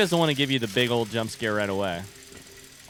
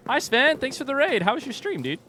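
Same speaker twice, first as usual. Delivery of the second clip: cheery greeting; 13 semitones higher than usual. Real, not acted. Greeting a raider.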